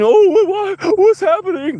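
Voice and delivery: high, loud mocking wail; mock panicked crying. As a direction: whine it in hammed-up panic. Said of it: "Don't start crying".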